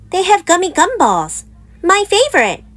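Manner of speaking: put-on high whining little girl voice; whiny cub. A narrator's reading. Little Sister whining to Mama for candy. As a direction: whine it up high like a pleading child. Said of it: "whined Sister".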